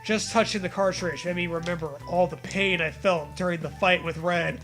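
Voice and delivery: ordinary delivery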